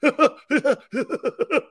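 a put-on laugh for the microphone; radio laugh. Shown as a demonstration. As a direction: throw out a showy broadcast laugh. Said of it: "My wife used to call it a radio laugh".

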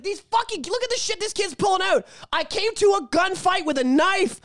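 high mock whiny complaint; frustrated kid. Kid complains about the other player, high whiny mock. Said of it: "I'd be like".

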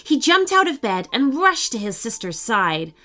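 plain reading voice

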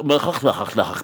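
low guttural mock gibberish; throaty nonsense syllables imitating a foreign speaker; imitated foreign babble. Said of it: "Except she's saying it like".

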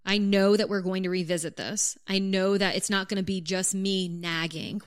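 usual speaking voice

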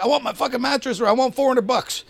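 high-pitched mock demand; retold angry demand. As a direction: imitate a worked-up friend making demands.

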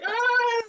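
high-pitched imitated cry; high mock wail; mock exasperated cry. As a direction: cry out like a fed-up driver, half laughing. Imitating the exasperated woman driver.

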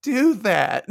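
high, slow, drawn-out scold; telling Percy not to celebrate, slowed and high; exasperated warning. Real, not acted. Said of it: "I'm like".

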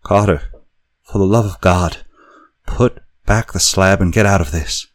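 high, loud, frantic warning; wild consternation. A narrator's reading. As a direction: plead in wild panic. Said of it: "in a pitch of wilder consternation".

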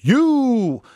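high, loud held note; playful high drawl. Pretend. Teasing about grandma coming to the rescue, high drawn-out word.

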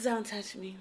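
quiet, clipped-off warning; soft, cold don't-touch-me; curt brush-off. Retold or imitated. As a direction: shut him down coldly. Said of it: "she said it just like that".

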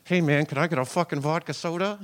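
cokehead talking through a clenched jaw; locked-jaw cokehead. Retold or imitated. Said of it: "talk through a locked jaw".

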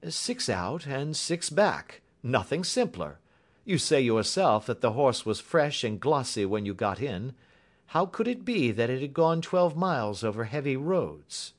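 a hint of a confident sleuth; assured detective. A narrator's reading. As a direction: reason it out step by step. Voices Holmes reasoning.

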